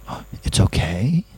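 tentative question, rising steeply at the end; retold incredulous relief with a questioning lift; disbelieving question. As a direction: check it in disbelief, lifting "okay". Retold disbelief at being forgiven.

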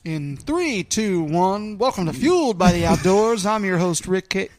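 loud show host intro; announcer voice. Acted. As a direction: open the show like a big announcer. Mock show intro countdown.

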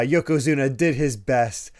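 ordinary talk

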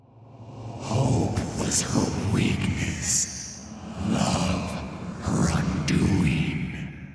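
slow rasping ghostly whisper; a sinister wraith savouring torment; cold hissing layered whisper. Acted. Thresh's voice.

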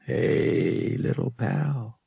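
sidle up sweetly to the droid; coaxing. A narrator's reading. Pilot sweet-talks the watch droid, coaxing. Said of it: "Han said generously".

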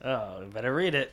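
soft, sly nudge; quietly knowing hint. Acted. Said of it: "You said that very slyly".

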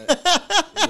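high, loud burst of laughing surprise; real shocked laugh; laughing disbelief. Real, not acted. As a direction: yelp it out through a laugh. Stunned by the song story.

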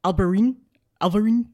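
mock Portuguese clip; clipped Portuguese. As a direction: imitate the locals swallowing the end of "Alvarin". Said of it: "they basically just say".

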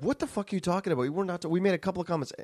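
quick, incredulous mock comeback; retold indignant retort. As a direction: imitate a friend firing back. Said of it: "my friend's like".

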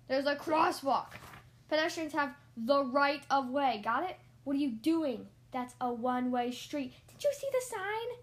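loud, emphatic driving instructor; irritated shouted correction; exasperated yelling. A narrator's reading. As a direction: scold the learner at full volume. Instructor yelling at learner driver.

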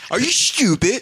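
high, loud, put-on "Are you stupid?"; mock high voice. Friends mocking a TV commercial in a high, silly voice. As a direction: squeak out the insult in a silly voice.

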